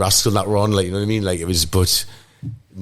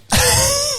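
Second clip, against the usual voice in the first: short laugh. Real, not acted. Laughing mid story.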